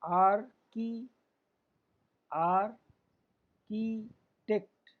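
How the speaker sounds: आर … की … टेक्ट, slowly with gaps; teacher's spaced-out three-beat demo; syllable by syllable. Shown as a demonstration. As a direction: hold each syllable apart. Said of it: "तीन स्वर का उच्चारण यहाँ पे हो रहा है".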